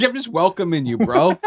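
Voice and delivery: high bro voice; high mock dude voice. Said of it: "He's like".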